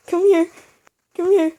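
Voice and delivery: loud, high taunting call; taunting shout. Real, not acted. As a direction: yell at him to come fight. Calling enemy to fight, rising.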